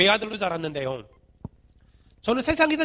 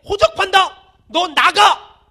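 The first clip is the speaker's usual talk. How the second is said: higher, louder mock shout of a fed-up father; angry father shouting; 6 semitones higher, 8 dB louder, much slower than usual. Father to child, hammed-up angry shout. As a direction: yell it like a father disowning his child. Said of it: "아버지가 자식한테".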